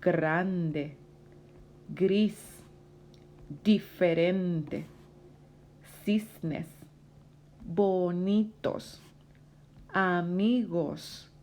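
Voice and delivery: slow, drawn-out words with pauses between; word-list read-aloud. Shown as a demonstration. Teacher reads vocabulary for students to repeat, slow model reading. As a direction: enunciate each word for learners to repeat. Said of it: "Repite conmigo".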